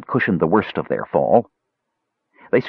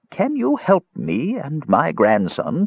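put-on high, slow old woman pleading; aged, cracking voice; 8 semitones higher, slower than usual. A narrator's reading. Old woman begging travelers to help her grandson, aged cracking voice. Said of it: "in an aged, cracking voice".

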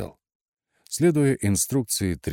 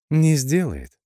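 high, drawn-out, knowing tease; ironic drawl; 10 semitones higher, 6 dB louder, slower than usual. A narrator's reading. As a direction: draw it out with playful irony. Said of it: "иронично протянула". (иронично, нараспев)

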